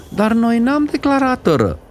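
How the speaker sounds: high indignant mother; mother crying out; mild mock cry. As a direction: protest to the conductor. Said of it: "strigă mamița".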